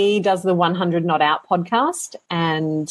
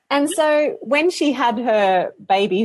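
ordinary talk, nothing marked

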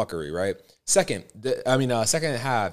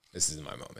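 whisper; 8 semitones higher, 8 dB quieter than usual. Retold or imitated. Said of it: "And I was like".

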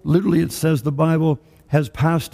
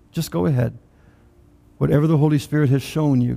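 usual speaking voice

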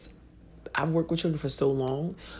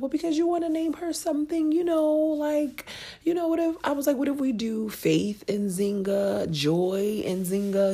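pleading wife; 12 semitones higher than usual. Retold or imitated. To her husband Ayub, coaxing about a middle name, much higher. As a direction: sweet-talk the name idea. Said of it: "And I was like".

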